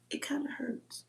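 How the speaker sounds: hushed, tearful whisper; soft voice on the verge of crying; near tears. Real, not acted. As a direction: let it catch in the throat as it hurts. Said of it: "I'm gonna start crying".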